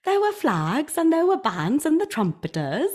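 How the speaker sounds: high, swooping Welsh lilt; attempted Welsh accent. Shown as a demonstration. Describing the happy fans, attempted Welsh accent. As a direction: keep a sing-song Welsh accent going. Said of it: "That was all right".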